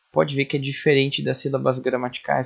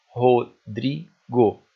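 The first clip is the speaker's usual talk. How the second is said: very slow, louder, each syllable of Rodrigo separate; 5 dB louder, much slower than usual. Shown as a demonstration. Teacher counting syllables up to the stressed one, syllable by syllable. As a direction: split Rodrigo into Ro, dri, go with pauses. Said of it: "até o dri do Rodrigo".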